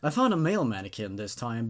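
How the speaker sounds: ordinary delivery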